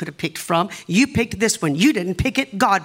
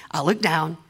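ordinary talk, nothing marked

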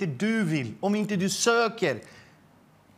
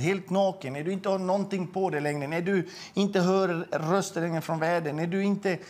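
ordinary delivery

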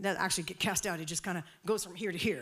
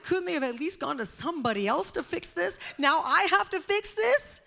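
high, loud incredulous complaint; exasperated disbelief; 9 semitones higher, 5 dB louder than usual. Retold or imitated. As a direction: protest climbing higher to the end. Retells being landed with the problem.

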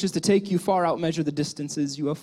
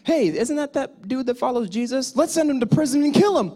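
mock crowd; 7 semitones higher than usual. Accusing crowd voiced.

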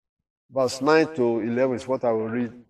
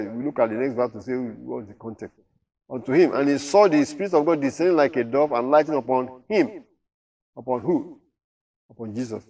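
normal talking voice

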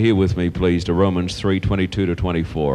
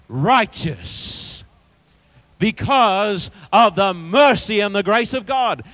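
deliberate, stretched key words with pauses; ringing preacher's teaching, heavily stressed; emphatic preaching; 11 semitones higher, slower than usual. Real, not acted. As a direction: proclaim doctrine to a congregation, word by word. Preaches justification to congregation.

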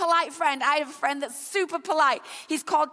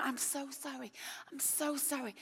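hushed apologetic murmur, much softer; sheepish sorry, barely voiced; very quiet apology; 3 semitones lower, 17 dB quieter than usual. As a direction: murmur the sorry under your breath. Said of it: "he said it one more time".

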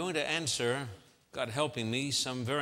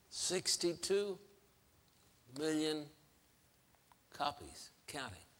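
hushed emphasis; 7 dB quieter than usual. Real, not acted. Preacher repeating sales figure, hushed and spaced out. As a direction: let it sink in, fading to very quiet.

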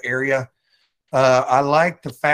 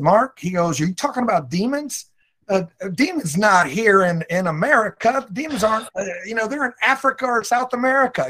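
raised pitch, scoffing pastor who doubts demons; mock the disbelieving pastor, higher; 7 semitones higher than usual. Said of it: "he said".

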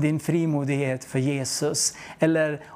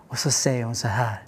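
lower, unvoiced whisper; whispered narration; 8 semitones lower, faster than usual. A narrator's reading. Narrating the girl's answer in a whisper. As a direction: keep the hush going while telling it. Narration carried into hushed scene.

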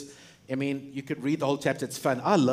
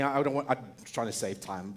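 usual speaking voice

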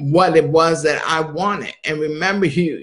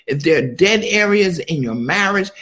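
ordinary talk

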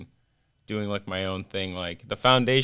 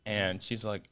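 normal talking voice

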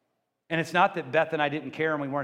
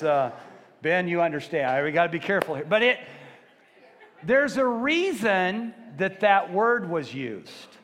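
usual speaking voice

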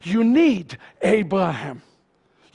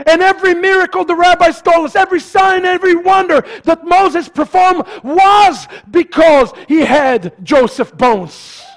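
high, insistent pulpit build; rousing preaching; 6 semitones higher, 4 dB louder than usual. Real, not acted. Preacher telling of Moses' wonders, rousing preaching. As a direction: pile up each item with force.

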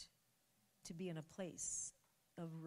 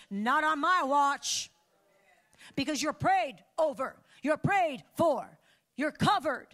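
loud, hammering sermon, higher; fiery preaching; 8 semitones higher, 11 dB louder than usual. Acted. Pastor warning the church, loud preaching. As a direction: pound each point home.

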